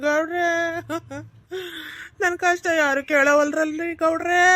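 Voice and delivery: drawn-out, sobbing wail; mock crying. Husband complains to the village headman, hammed-up crying. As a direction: wail slowly between hammed-up sobs. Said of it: "ಅಳ್ಬೇಡಪ್ಪ".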